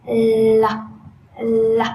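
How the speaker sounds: loud ringing L sound, tongue curled up; loud clear L. Shown as a demonstration. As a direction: belt out "L" clearly. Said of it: "Đấy là âm L cao đấy ạ".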